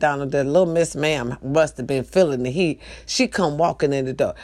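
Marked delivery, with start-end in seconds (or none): none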